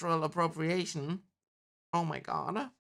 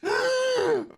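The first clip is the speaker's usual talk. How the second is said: big high shrieking gasp; loud gasp; 6 dB louder than usual. Acted. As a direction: gasp like the whole room is stunned. Bear about to enter court.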